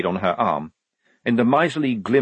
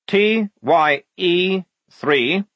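high, slow, louder code reading; spelling out; 9 semitones higher, 5 dB louder, much slower than usual. Acted. Lieutenant making notes of the code, slowly spelling out. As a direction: space out each symbol.

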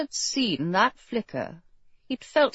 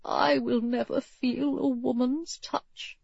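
wistful inner thought; 3 semitones higher, 6 dB quieter than usual. A narrator's reading. Dying king thinking of a woman's touch, wistful and soft. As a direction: voice the thought softly, regretfully. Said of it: "He thought".

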